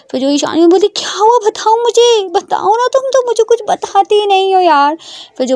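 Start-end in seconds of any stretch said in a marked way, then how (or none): 0.9-5.0 high-pitched, urgent coaxing; worried Ishani. Acted. Ishani asks Prita what happened, worried. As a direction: press anxiously to be told.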